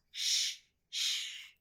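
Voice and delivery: unvoiced shh shh; sh digraph sound; shushing sh sound. Shown as a demonstration. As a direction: hush like a librarian. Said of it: "And a digraph".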